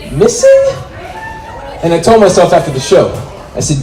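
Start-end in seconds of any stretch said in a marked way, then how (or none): 0.1-0.7 squeaky incredulous punchline. Acted.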